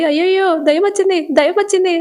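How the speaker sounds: high panicked cry of ghost; startled by the light, crying out in fear; frightened. A narrator's reading. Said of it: "భయపడి". (భయంతో కేకలు)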